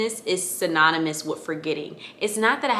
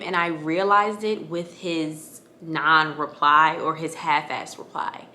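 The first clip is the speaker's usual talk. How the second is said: ordinary talk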